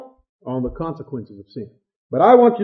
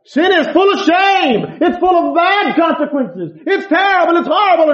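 loud preaching warning; 12 semitones higher, 8 dB louder than usual. Acted. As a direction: lean in hard and loud, warning the flock. Samson voiced warning against sin.

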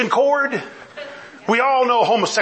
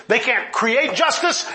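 usual speaking voice

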